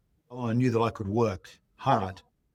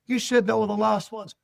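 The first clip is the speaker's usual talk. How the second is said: higher, put-upon customer voice; mock indignant customer; 11 semitones higher than usual. Customer complaining to the young ticket seller, mock indignant. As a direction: act caught out by a tricky seller.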